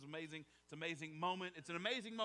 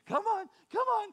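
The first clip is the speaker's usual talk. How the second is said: high, sing-song coaxing; coaxing a toddler; 10 semitones higher, 5 dB louder than usual. Retold or imitated. Parent coaxing baby's first steps, high sing-song. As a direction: call baby over with bright encouragement. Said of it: "you did this thing".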